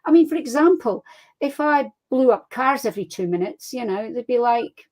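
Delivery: normal talking voice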